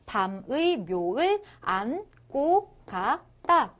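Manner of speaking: 밤의 묘을 안고 가 따 slow, piece by piece; segmented reading. Shown as a demonstration. Teacher shows the borrowed characters, 밤의 묘을 안고 가 따 separated. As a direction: pause between each piece of 밤의 묘을 안고 가 따. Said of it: "이런 식으로 빌려 썼다".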